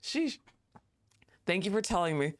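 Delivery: ordinary talk, nothing marked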